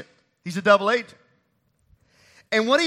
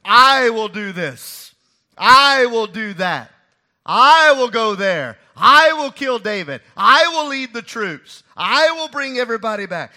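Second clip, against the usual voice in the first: boastful Ahithophel; 2 semitones higher, 10 dB louder than usual. Retold or imitated. Preacher voicing Ahithophel's boasts to the church, loud and bragging. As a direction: hammer each I will like a proud boaster. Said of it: "what was it that Ahithophel was saying".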